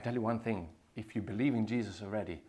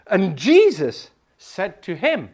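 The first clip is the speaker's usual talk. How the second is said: loud, slow, raised proclamation; slow loud preaching; 6 semitones higher, 14 dB louder, slower than usual. Real, not acted. Preacher introducing the verse, loud and slow. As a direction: ring it out slowly.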